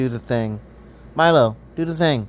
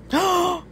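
delighted gasp. Real, not acted. Cat finally does the meow.

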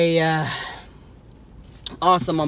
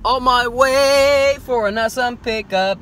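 loud, higher hyped yelling, stretched out slowly; excited shouting; 10 semitones higher, 8 dB louder, slower than usual. Real, not acted. Showing off his new pickup to viewers, shouting with excitement. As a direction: shout it out like the package just landed.

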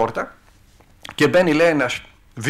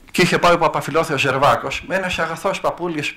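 ordinary talk

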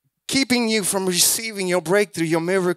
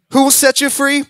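loud, higher rhetorical question; preaching call; 6 semitones higher, 4 dB louder than usual. Acted. Preacher quizzing the congregation, loud call. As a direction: challenge the room. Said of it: "I said".

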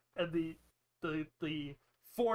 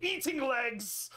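acted distress, pitched up; mildly upset outburst, higher voice; distraught; 8 semitones higher, 5 dB louder, slower than usual. Landowner upset about the beast attacking people, acted distress. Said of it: "he's clearly very distraught".

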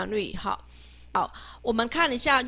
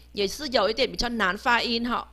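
normal talking voice

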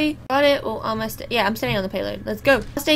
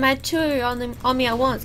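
normal talking voice

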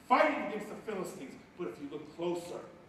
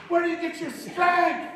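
mock question; 13 semitones higher, 13 dB louder than usual. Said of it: "and say".

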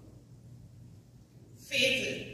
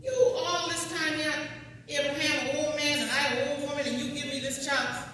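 higher and louder, aggrieved; Sarah pleading; 8 semitones higher, 5 dB louder than usual. Retold or imitated. Teacher voicing Sarah reminding God of her late child, higher and aggrieved. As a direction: plead like an old mother given a child at last.

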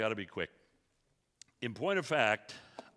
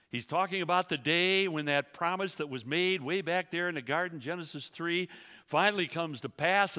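higher, louder preaching build; fervent preaching; 5 semitones higher, 3 dB louder than usual. Acted. Preacher proclaiming the promised Savior to church, fervent. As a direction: lift them toward the promise.

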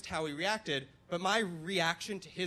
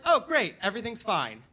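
cheerful relief; 4 semitones higher, 7 dB louder than usual. Retold or imitated. Dad suddenly cheerful.

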